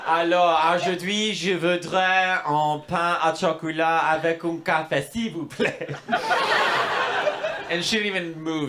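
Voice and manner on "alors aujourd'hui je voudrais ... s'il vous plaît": slow, deliberate French order; trying hard to sound French; a touch of put-on French; careful French. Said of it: "and I was like".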